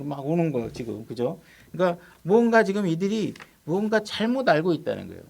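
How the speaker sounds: ordinary delivery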